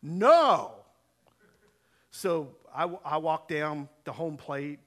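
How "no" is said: loud, drawn-out mock refusal; hammed-up emphatic no; retold refusal. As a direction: refuse outright, louder, as the stubborn rival. Jim bluntly refuses in the story.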